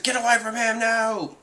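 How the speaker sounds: high-pitched pretend panic; mock freaking out. Tashigi warning the men off Virgo, mock freaking out. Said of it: "like, freaking out".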